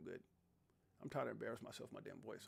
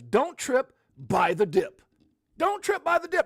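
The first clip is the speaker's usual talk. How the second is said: loud, high rallying cry; slogan shouted out; 12 semitones higher, 11 dB louder, slower than usual. Real, not acted. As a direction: hammer out the catchphrase to hype viewers. Chanting his slogan to viewers.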